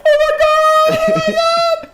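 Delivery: very high, loud screech; hammed-up hysterical squeal; mock panicked driver. Imitating panicking Uber driver.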